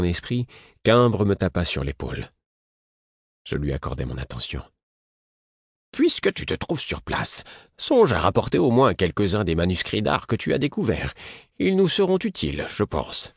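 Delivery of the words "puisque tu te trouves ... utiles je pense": lightly voiced Imbre. Old Imbre voiced, request.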